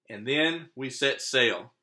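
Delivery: usual speaking voice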